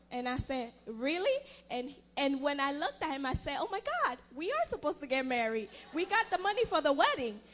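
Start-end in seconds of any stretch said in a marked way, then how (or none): 0.9-1.4 surprised really. Retold or imitated. Learns money came for wedding. 3.6-7.4 excited realization. Retold or imitated. Realises they can marry now.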